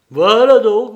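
loud, high burst of indignation; indignant outburst. A narrator's reading. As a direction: hit it hard and high. Belzoni confronts the husband.

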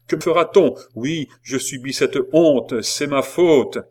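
loud, bitter outburst; impassioned defiance. A narrator's reading. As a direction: declaim it to the whole square. Condemned woman cries defiance at officials.

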